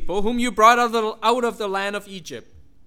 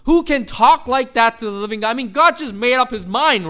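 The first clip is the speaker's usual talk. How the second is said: usual speaking voice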